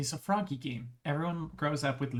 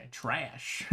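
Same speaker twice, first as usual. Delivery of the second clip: normal talking voice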